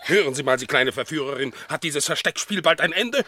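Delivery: high, whining complaint; agitated protest. Acted. Dog demands the cat stop her hide-and-seek, agitated. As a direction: plead with rising exasperation.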